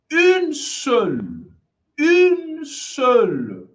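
slow, drawn-out Une seule; slow polite answer; slow client reply. Acted. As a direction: stretch each word like a guest answering.

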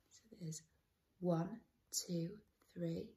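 soft counting, half whispered; quiet whispery counting; hushed aside. Real, not acted. Aside counting dinosaurs with child.

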